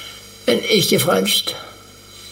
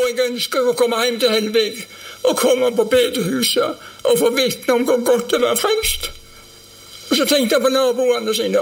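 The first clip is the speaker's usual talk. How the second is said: higher, urgent sermon voice; fervent preaching; 5 semitones higher than usual. Acted. Preacher urges the congregation to witness, fervent and higher. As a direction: press the gospel home to the hall.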